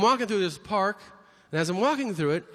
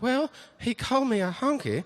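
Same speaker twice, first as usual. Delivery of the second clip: hillbilly; 5 semitones higher than usual. Retold or imitated. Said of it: "they'll find some hillbilly".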